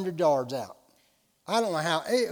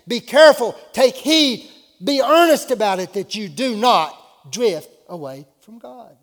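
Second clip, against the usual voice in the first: loud, higher-pitched driving sermon; fervent preaching; 6 semitones higher, 10 dB louder than usual. Real, not acted. Preacher warning believers against drifting from God, fervent preaching. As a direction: build urgent warnings phrase on phrase.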